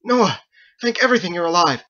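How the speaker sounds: half-drowned man gasping his relief; spluttered. Acted. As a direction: splutter it out soaking and winded. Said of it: "and spluttered".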